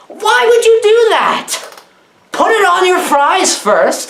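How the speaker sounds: high, slow, disbelieving scold; a high drawn-out why-would-you; exasperated mock scolding. Real, not acted. Mocking the alien's choice.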